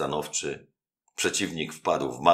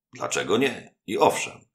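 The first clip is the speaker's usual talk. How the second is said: boldly; 6 semitones higher, 4 dB louder than usual. A narrator's reading. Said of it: "odrzekł Anastazjo śmiało".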